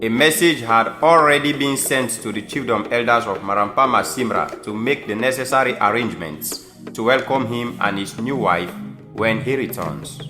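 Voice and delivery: normal talking voice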